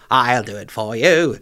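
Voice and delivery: lightly hammed-up comedian impression; loud, slow mock offer; Alan Carr. Comedian offering to take over the song, mock-gallant impression. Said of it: "Alan Carr".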